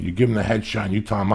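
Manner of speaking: usual speaking voice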